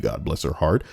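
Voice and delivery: normal talking voice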